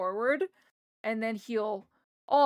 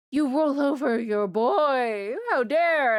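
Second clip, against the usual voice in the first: loud, outraged mock cat protest; slow, loud, hammed-up indignation; indignant pet voice; 4 semitones higher, 7 dB louder, slower than usual. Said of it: "You'll go".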